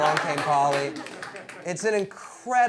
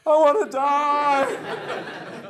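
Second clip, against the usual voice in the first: 1 mortified wail; 9 semitones higher, 5 dB louder, much slower than usual. Retold or imitated. Teen mortified by dad's talk.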